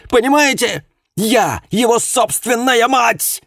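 high, loud screaming fury; explosive rage. Acted. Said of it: "Голос миссис Уилкинс сорвался на крик".

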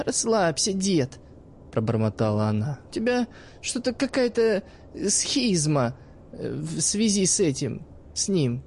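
put-on sly teenage girl talking to the old man; cheeky girl's voice. Narrator voices the girl Iskra.

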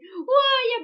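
high, loud squeal of joy; delighted. A narrator's reading. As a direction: burst out thrilled. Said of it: "обрадовалась мартышка". (радостно, с восторгом)

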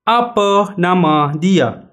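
Apa nama Anda said very slowly, word by word; slow teacher demo; slow model phrase. Shown as a demonstration. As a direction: spell it out slowly for learners to repeat. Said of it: "مرة أخيرة".

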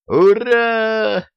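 high, loud cheer; cheering shout. A narrator's reading. Brothers cheering as the vehicle starts, a loud high whoop. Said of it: "заорали братья".

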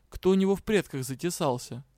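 ordinary delivery, nothing marked

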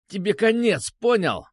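high-pitched threat; defiant bluster. A narrator's reading. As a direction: bluster back while held captive. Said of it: "продолжал ерепениться он". (ерепениться, угрожать)

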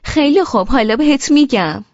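wife answers husband with stubborn loud defiance. A narrator's reading. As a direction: spit it back out of pure stubbornness. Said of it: "با لجبازی گفتم".

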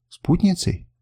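narrated surprise on a single word; amazed question. A narrator's reading. Woman echoing the warrior's offer, amazed. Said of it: "изумлённо пробормотала она".

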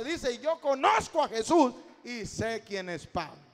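loud, rising sermon climax, calling out the name of Jesus; fervent evangelical preacher thundering to the congregation; full-force preaching. Acted. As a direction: preach it at the top of your voice.

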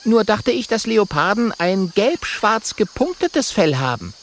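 high, protesting little voice; high-voiced story character.

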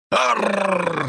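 a high monstrous roar; roaring grunting brute. Acted. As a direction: let out a beast's roar. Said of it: "взревела скотина". (рёв чудовища)

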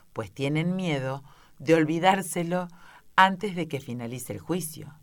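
ordinary delivery, nothing marked

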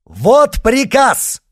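slow, high, loud shout; drawn-out ringing yell; triumphant shout. A narrator's reading. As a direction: proclaim it to the crowd. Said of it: "крикнул он".

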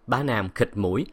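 ordinary delivery, nothing marked

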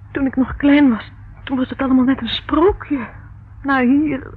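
put-on frightened girl blurting out her fears; distressed outburst. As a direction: pour it out, overwhelmed.

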